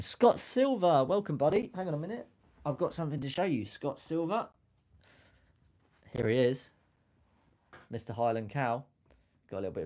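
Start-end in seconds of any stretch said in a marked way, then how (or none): 0.0-4.5 excited greeting. Real, not acted.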